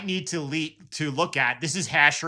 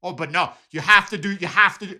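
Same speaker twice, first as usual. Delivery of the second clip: higher, louder, pushy purist; imitating a Bitcoin purist who insists there is one right way; mocking insistent maxi; 5 semitones higher, 6 dB louder, faster than usual. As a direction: hammer the rule at them in mock insistence. Maxi voiced in retold argument.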